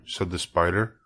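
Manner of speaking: ordinary delivery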